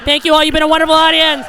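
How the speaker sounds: bow out like a stage host; mock showman. Comic thanking her stoned parents, grand and loud. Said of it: "I go".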